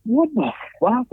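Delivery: high, drawn-out incredulous question; retold disbelief. Retold shock at friend's abuse.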